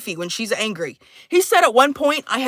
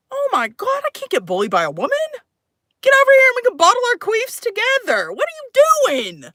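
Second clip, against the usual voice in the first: hammed-up whiny outburst, higher; mock tantrum, pitched up; high mock rant; 12 semitones higher than usual.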